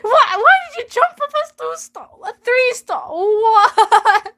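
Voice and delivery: high, laughing, voice cracking; real laughing voice break. Real, not acted. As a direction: crack up mid-question. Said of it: "C-, stop breaking".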